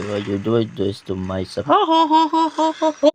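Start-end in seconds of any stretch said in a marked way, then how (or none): 1.7-3.1 high, loud "ha ha ha" cackle; taunting high laugh at a teammate; mocking cackle. Real, not acted. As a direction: cackle in someone's face.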